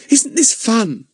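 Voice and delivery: put-on high, eager girl's voice; girlish voice. A narrator's reading. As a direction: voice a bright young girl. Eunice's girlish voice.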